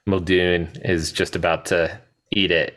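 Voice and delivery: ordinary talk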